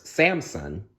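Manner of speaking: usual speaking voice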